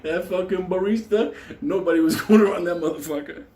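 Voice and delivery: building to the punchline, laughing; excited. Real, not acted. Telling the audience about the killer, excited and laughing.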